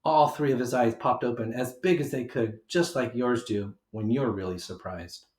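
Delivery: ordinary delivery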